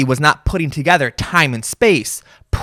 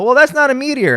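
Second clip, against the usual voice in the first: high, dismissive expert voice, pitched well up; know-it-all expert; 11 semitones higher than usual. Retold or imitated. Expert telling the finder it is no meteor, high and dismissive. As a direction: play the smug expert waving off the rock. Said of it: "pull out the flashlight and go".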